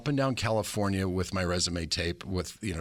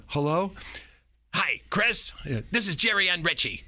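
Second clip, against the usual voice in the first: high, bright New York woman on the phone; higher-pitched, chirpy New York lady; 10 semitones higher than usual. Retold or imitated. Imitating the New York woman calling him back, higher New York voice. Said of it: "one of these voices from New York".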